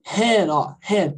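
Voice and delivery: "Ham" opened with a clear H, like a Brazilian R; sounded English H. Shown as a demonstration. Teacher isolating the first syllable for students, "Ham" with sounded H. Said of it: "Parece que tem um R".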